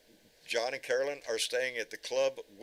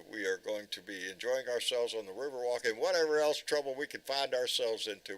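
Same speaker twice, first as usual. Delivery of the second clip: usual speaking voice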